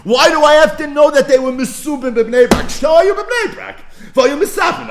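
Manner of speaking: high, loud rising challenge to the text; fired-up teacher demanding an answer; emphatic excitement. Real, not acted. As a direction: press the question hard, building to a shout. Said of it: "What's the emphasis?".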